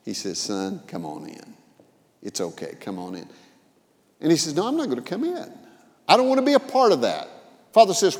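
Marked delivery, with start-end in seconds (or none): 0.4-3.3 gentle coaxing. Acted. Father gently coaxes older son. 4.5-7.3 defiant refusal. Acted. Older son refuses father.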